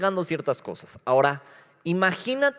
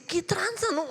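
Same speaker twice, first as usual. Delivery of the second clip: high, teasing mock greeting; mock slang greeting; 14 semitones higher than usual. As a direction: toss it off like a street kid. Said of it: "Y tú así de".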